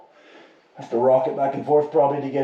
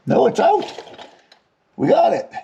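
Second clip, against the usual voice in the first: excited; 11 semitones higher than usual. Real, not acted. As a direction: celebrate finally getting it loose. Bolt finally comes free.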